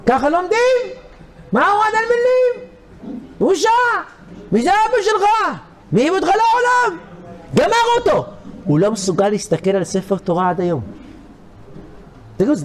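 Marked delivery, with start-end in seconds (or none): 0.1-8.2 retold shouting old man. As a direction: shriek at the boy like an angry old man. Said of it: "צעק עליו".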